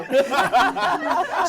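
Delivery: loud real laugh; bright laugh out loud; burst of laughter. Real, not acted. Laughs at the mouth-full joke.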